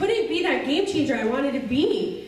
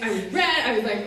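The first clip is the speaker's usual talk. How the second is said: usual speaking voice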